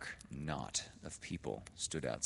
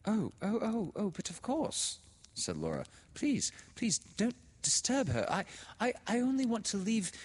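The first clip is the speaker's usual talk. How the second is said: much higher, louder, stammering panic; Laura flustered; 5 dB louder than usual. A narrator's reading. Frightened girl begs the sister, much higher and stammering. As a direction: stammer out, desperate to leave.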